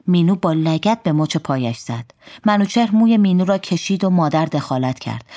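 ordinary delivery, nothing marked

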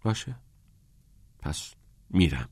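resigned, quiet surrender; helpless. A narrator's reading. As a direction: give in helplessly. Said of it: "عاجزانه گفت".

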